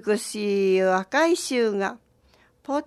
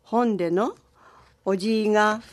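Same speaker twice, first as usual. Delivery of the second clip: ordinary delivery, nothing marked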